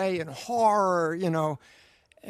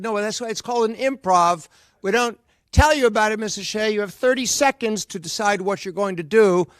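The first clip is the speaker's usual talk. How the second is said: mock stern order; 3 semitones higher, 7 dB louder, faster than usual. Retold casting staff barking orders.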